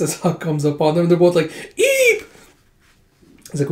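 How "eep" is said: very high squeak of fright; tiny high startled yelp; startled eep. Retold or imitated. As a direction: squeak like caught red-handed. Both startled by Huck arriving.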